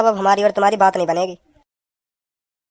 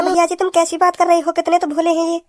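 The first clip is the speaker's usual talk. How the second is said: put-on higher-pitched young girl's voice, sweetly defending her brother; girlish voice; 7 semitones higher than usual. Sister defends her brother to the guests, girlish voice. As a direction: play the innocent young sister.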